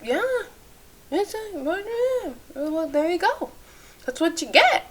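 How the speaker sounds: high, soft, airy put-on husband voice; lightly mocking a too-calm husband; unbothered husband. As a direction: shrug it off in a thin soft voice. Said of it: "he'd be like".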